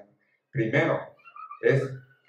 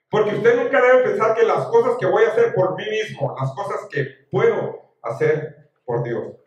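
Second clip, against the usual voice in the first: loud, high-pitched sermon delivery; impassioned preaching; 7 semitones higher, 7 dB louder than usual. Real, not acted. Preacher urging followers of Jesús to trust, impassioned preaching. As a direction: preach with force, driving each point to the congregation.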